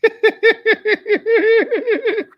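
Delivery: loud, high-pitched cackle; high staccato laugh. Real, not acted. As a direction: burst into loud, rapid giggles. Reacts to played clip's plea.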